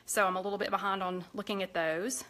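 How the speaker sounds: ordinary talk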